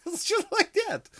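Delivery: very high mock Russian catchphrase; Russian accent. Russian nurse reassuring a scared boy, high Russian accent. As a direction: squeal it like a parody Russian nurse. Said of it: "with a Russian accent".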